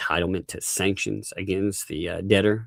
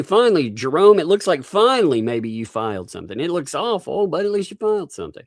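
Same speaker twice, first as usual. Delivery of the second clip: mock praise; 7 semitones higher than usual. Sarcastic mock praise for Jerome.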